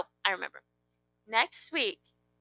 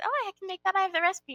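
bright eager offer, lightly higher; retold offer, higher; 9 semitones higher, faster than usual. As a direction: replay your own eager offer. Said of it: "I said".

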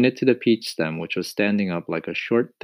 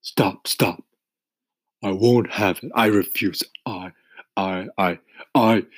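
crying out; 2 semitones higher, 4 dB louder than usual. A narrator's reading. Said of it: "cried the earthworm".

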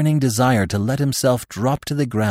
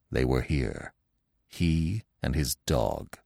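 hushed; 7 semitones lower, 8 dB quieter, slower than usual. A narrator's reading. Stag hushed near hunter's trail.

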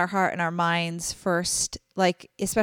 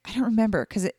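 ordinary delivery

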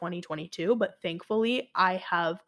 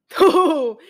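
loud laugh; 6 semitones higher, 11 dB louder than usual. Real, not acted. Laughing about awful finals week.

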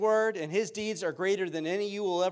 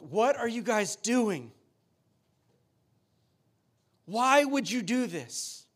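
slow, drawn-out exasperated challenge; sharp rebuke; 3 semitones higher, slower than usual. Retold or imitated. Preacher voices Paul confronting straying believers, sharp and slow. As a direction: imitate Paul's sharp, deliberate reproach. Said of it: "you see some sharp words from Paul".

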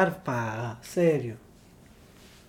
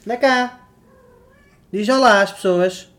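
calling the cat; 3 semitones higher, 6 dB louder, slower than usual. Real, not acted. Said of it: "chamo a minha gata como se fosse um cão".

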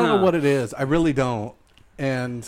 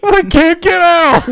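much higher, slow, loud whine; high bear voice; 13 semitones higher, 7 dB louder, slower than usual. Pretend. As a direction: wail it like a stuck bear. Bear trapped.

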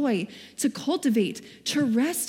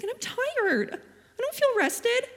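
much higher, slow exasperated whine; whiny complaint; 7 semitones higher than usual. Retold or imitated. Complaining to God about not sleeping, high whine. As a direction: plead like you're fed up and exhausted. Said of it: "I was like".

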